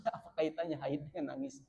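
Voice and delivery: ordinary talk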